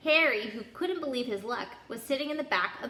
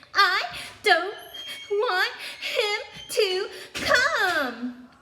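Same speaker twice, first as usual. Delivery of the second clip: much higher, much louder wailing fake cry, dragged out slowly; fake sobbing yell; 11 semitones higher, 6 dB louder, much slower than usual. A narrator's reading. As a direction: bawl it like a spoiled child faking tears. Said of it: "Dudley yelled between the huge pretend sobs".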